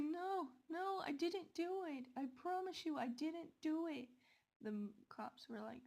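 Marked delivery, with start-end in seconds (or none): 0.0-4.1 high, panicked pleading; frantic denial. Retold or imitated. Girl begs the police she is innocent, high and panicked. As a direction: beg them to believe you.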